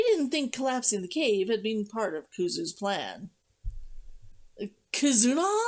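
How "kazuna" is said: calling a name into silence. A narrator's reading. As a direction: call out, searching, voice raised. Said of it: "he called out".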